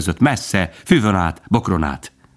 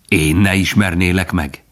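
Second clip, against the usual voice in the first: ordinary delivery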